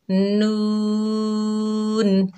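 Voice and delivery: नून drawn long and clean, not nasal; नून the right way, no nasal sound; correct pronunciation. Shown as a demonstration. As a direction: stretch नून clearly, five alif length. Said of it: "तो हमने कैसे पढ़ना है?".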